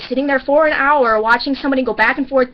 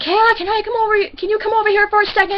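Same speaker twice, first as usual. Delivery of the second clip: higher and quicker, a hint of a stern boss; angry manager; 10 semitones higher than usual. Sue the manager summoning teller, mildly mock angry. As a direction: call across the room, tightly. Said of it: "and she got really angry".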